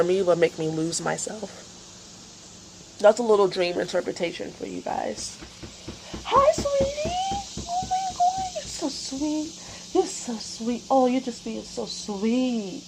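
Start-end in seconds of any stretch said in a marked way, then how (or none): 6.2-12.8 much higher, soft, slow cooing at the pet. Real, not acted.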